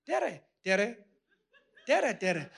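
"Tere, tere" repeated with tapped r, laughing on the last; laughing Estonian hello. Shown as a demonstration. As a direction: play with "Tere" as an Estonian greeting, chuckling. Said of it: "That's how they say hello".

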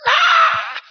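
high, loud mock karate yell; boy's fierce battle cry; karate scream. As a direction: throw everything into a kiai shout. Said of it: "And I would go".

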